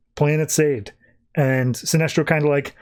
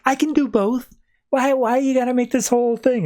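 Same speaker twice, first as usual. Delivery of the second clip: much higher, put-on voice for Sinestro; high mock Sinestro; 10 semitones higher than usual. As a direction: squeak out Sinestro's whiny protest. Sinestro quoted in comic recap.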